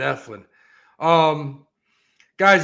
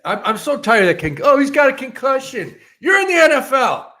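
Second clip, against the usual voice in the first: shouting, much higher and louder; angry rant; 9 semitones higher, 6 dB louder than usual. Real, not acted. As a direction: yell it out in disbelief. Angry rant about football players.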